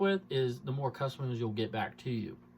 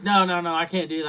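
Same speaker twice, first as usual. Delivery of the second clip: quick run of mock protest; mock alarmed refusal; 6 dB louder than usual. Salesman mimics wife refusing another down payment, mock alarm. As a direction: imitate a customer protesting, a bit louder.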